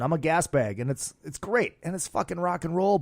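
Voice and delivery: ordinary talk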